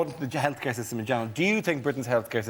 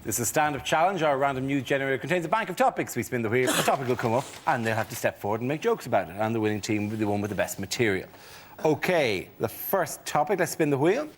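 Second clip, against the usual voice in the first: game show host; 5 semitones higher than usual. Acted. Host announces rounds.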